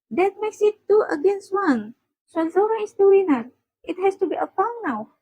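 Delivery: high-pitched, lively verdict; triumphant declaring. Acted. Settling who won the shoe argument, high and triumphant.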